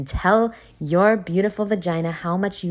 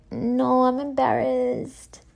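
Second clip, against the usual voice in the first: high, slow, whiny "no"; whiny refusal; 7 semitones higher, much slower than usual. Retold or imitated. Turning down the roommate, a high, slow, whiny no. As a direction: imitate her younger self shrinking away, higher and slower. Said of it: "And I was like".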